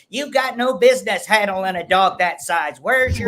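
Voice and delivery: high, loud, scolding mock-Karen voice; high-pitched Karen. Dog owner mimics online critics in his video, high-pitched mock Karen. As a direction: pitch your voice up like an indignant comment Karen. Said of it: "I just kinda high-pitched my voice and say".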